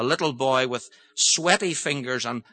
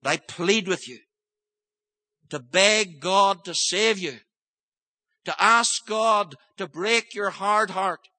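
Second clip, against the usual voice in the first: earnest pleading; 5 semitones higher than usual. Acted. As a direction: beg the listeners to seek God. Preacher pleads with listeners.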